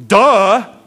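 playfully exaggerated scorn; mock duh. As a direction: blurt it as if stating the obvious. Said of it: "your grandma basically says to you".

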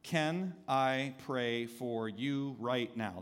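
very slow, every word spaced; soft, deliberate, word by word; slow gentle offer. Shown as a demonstration. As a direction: lay each word down slowly. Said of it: "Seven words that can change your life".

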